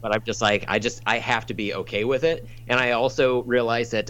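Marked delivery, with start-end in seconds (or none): none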